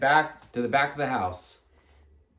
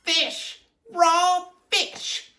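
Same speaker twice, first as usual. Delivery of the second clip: screamed; 14 semitones higher, much slower than usual. A narrator's reading. Miss Ralph finds fish in chocolate, high scream. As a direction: cry each word out in a high voice. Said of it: "she screamed".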